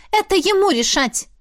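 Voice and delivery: sharp, high, decisive; firm retort. A narrator's reading. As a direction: slam the verdict down. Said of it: "девушка припечатала".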